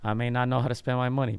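ordinary talk

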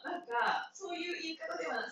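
ordinary talk, nothing marked

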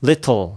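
"Little" with a clearly released T; British-style "Little", T fully pronounced; British crisp T. Shown as a demonstration. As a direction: sound out the T in "Little" firmly. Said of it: "しっかり発音するんですよ".

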